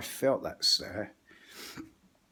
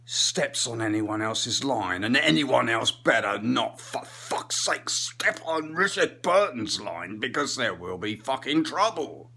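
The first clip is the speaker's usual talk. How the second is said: hammed-up angry thespian, slow and loud; Richard Burton; 3 dB louder than usual. Comic as actor warning line-stealers, slow hammed-up anger. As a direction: stretch the words in theatrical fury. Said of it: "he wouldn't get that angry".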